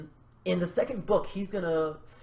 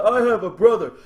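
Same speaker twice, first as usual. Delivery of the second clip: loud, high, hammed-up revelation; mock dramatic reveal; 6 semitones higher, 9 dB louder than usual. Author acts out the brother reveal, hammed-up and loud. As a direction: blurt it out as a big plot twist.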